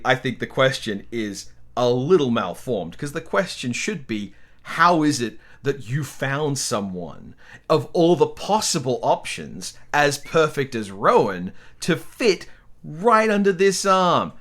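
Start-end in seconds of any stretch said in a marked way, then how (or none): none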